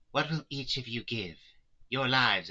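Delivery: plain reading voice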